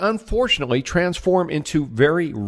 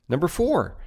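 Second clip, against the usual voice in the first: usual speaking voice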